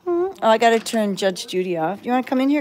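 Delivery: ordinary delivery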